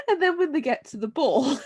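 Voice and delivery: ordinary talk, nothing marked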